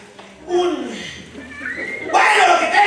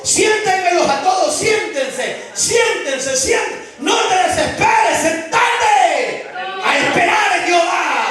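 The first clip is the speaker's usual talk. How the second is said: commanding shout; 4 semitones higher, slower than usual. Retold or imitated. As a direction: order them to sit, shouting. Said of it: "Jesús les dijo".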